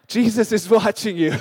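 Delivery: a hint of a menacing dog, punched out; Rottweiler voice.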